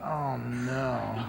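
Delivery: low, soft groan of dismay; dismayed under breath. Retold or imitated. Seeing a crying mother and daughter, a low soft groan. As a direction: sink into embarrassment.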